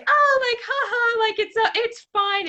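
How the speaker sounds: high, loud mock laughing voice; imitating dismissive laughter. Woman brushing off her faked confession to partner, mock laughing. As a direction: mimic her laughing it off, pitch way up. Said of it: "she was laughing about it".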